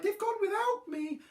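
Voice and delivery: high little lament; cried out. A narrator's reading. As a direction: wail it lightly, up high. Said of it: "he cried".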